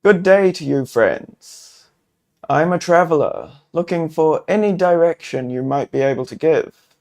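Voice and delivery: polite traveler introducing himself; friendly call. Acted. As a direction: hail them politely from a distance. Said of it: "you call out".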